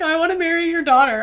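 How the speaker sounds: high-pitched fake-tearful suitor; mock crying. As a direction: imitate a weepy man pleading, half laughing. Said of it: "Imagine this man crying and being like".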